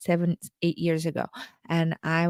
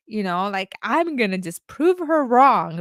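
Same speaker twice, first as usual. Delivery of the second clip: usual speaking voice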